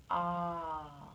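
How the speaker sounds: long, steady "ah" sigh; drawn-out "ah". Shown as a demonstration. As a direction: model one last long "ah". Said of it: "He's saying ah".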